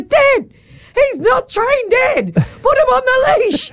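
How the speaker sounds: put-on very high, shouting child in a full panic; panicked kid. Kid in a panic begging dad to leash the dog, high shout. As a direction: yell at dad in a frantic kid's voice. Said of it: "just having a full panic".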